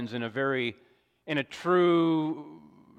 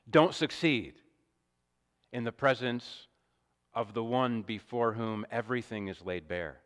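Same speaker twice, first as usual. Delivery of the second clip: ordinary talk, nothing marked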